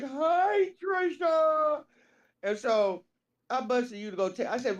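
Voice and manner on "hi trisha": high, wailing drawn-out greeting; Roger impression. Homeless man greeting the teller in traffic, high wailing impression. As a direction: pitch it way up and stretch it plaintively. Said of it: "Roger talks like this".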